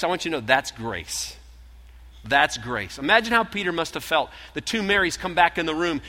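ordinary talk, nothing marked

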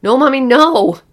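high, very loud panicked scream; child screaming. A narrator's reading. Lulu pulling mother off her sister, screaming. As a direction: scream it in desperate protest. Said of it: "Lulu began screaming".

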